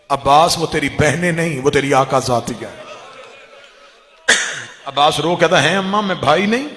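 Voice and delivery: loud, impassioned majlis recitation; full-force preaching. Acted. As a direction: cry the mother's words out to the mourners. (ज़ोरदार मजलिसी अंदाज़ में पढ़ना)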